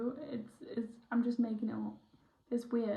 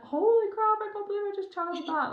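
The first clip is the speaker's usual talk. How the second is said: much higher and louder, rushed and thrilled; giddy excitement; 10 semitones higher, 5 dB louder than usual. Retold or imitated. Psychic retelling her own giddy rush after channeling, high and excited. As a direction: gush like you can't believe what just came through. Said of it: "all giddy".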